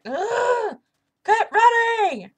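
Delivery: high, loud, slow frustrated yell; angry high shout at a fleeing player. Real, not acted. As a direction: bellow at the one who keeps fleeing.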